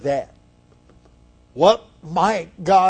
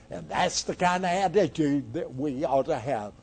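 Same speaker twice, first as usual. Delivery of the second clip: ordinary talk, nothing marked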